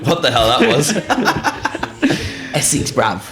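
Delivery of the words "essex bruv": put-on Essex accent. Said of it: "Go on, give that a try".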